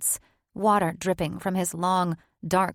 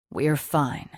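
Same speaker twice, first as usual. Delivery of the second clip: soothing whisper; 4 semitones lower than usual. Acted. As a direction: comfort her like a child. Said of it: "his voice dropping to a soothing whisper".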